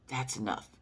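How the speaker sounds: soft low ancestral voice; ancestors whispering. Retold or imitated. Soft low voice of the ancestors telling her to stop seasoning. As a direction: murmur it like a voice in your head. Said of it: "the ancestors whisper to us".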